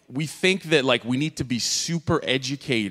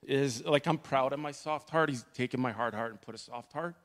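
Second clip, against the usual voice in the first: real tears, quiet and choked; holding back sobs while testifying; crying; 9 dB quieter than usual. Real, not acted. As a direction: speak through tears, softer. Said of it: "The reason why I'm crying".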